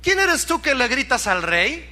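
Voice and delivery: high-pitched challenge to whoever is calling; Abner answering back. Acted. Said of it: "Abner respondió y dijo". (respuesta retadora con voz aguda)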